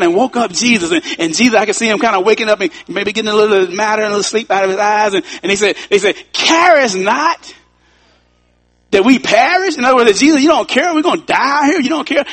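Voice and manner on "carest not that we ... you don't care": high, frantic pleading as the boat sinks; panicked disciples. Acted. Terrified disciples wake Jesus in the storm, high frantic pleading. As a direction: cry out that he doesn't care.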